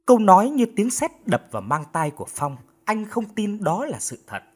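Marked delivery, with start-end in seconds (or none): none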